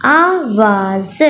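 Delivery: आवाज़ stretched very slowly, each sound held; teacher blending the word again. Shown as a demonstration. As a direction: draw out आवाज़ for children to copy. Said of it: "मैं दोबारा से पढ़ती हूँ".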